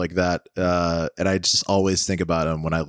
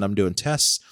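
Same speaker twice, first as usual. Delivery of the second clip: ordinary talk, nothing marked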